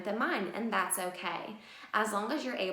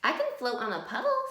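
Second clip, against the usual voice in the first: high, quick proud boast; proud duck; 5 semitones higher, faster than usual. Acted. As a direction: show off brightly and fast.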